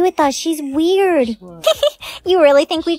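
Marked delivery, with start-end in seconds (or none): 1.6-2.2 mocking giggle. Mean girl mocks weirdo.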